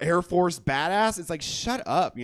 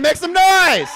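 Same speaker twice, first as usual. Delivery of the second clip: much higher, louder yelled call; louder hype call; 11 semitones higher, 9 dB louder, slower than usual. Real, not acted. Host demanding more noise, much louder and higher. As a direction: yell it even bigger for the crowd. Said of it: "make it a little louder".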